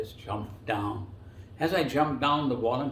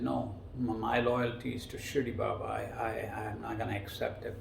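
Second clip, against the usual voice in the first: ordinary delivery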